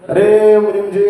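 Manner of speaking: loud call across the room. Acted. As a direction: summon the clerk with a loud call. Moneylender calls his clerk.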